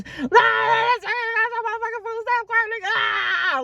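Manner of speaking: very high, drawn-out mimicked screech; hammed-up screaming rant; crazed screaming. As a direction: imitate her shrieking hysterically on the phone. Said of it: "she was going crazy".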